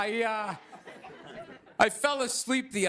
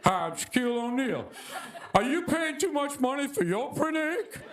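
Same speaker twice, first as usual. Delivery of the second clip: [name]. Retold or imitated. Shaq selling printer ink on late-night TV, pitchman voice. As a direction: deliver it like a TV infomercial spokesman. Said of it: "Standing there seven feet tall".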